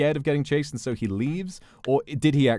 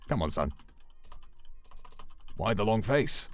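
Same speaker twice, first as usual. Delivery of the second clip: lower, lightly British, dismissive copper; British police officer; 4 semitones lower, 7 dB quieter than usual. Acted. Officer dismisses George in a low British accent. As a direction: brush him off like a bored bobby. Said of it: "The officer".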